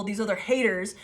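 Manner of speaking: ordinary talk, nothing marked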